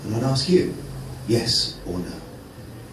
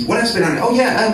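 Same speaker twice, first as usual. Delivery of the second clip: ordinary talk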